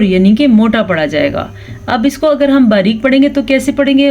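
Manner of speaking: ordinary delivery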